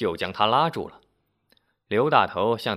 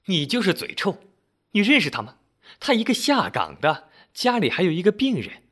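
high-pitched reproach; scolding auntie; 7 semitones higher, 3 dB louder than usual. A narrator's reading. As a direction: stick up for her, pitched high and indignant. Auntie scolds him, defending the woman.

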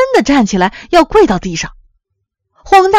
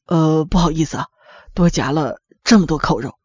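low, softer sheepish apology; apologetic; 7 semitones lower, 5 dB quieter than usual. A narrator's reading. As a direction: own up quietly, embarrassed at the excess. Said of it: "向餐厅小姐道歉". (压低声音不好意思地道歉)

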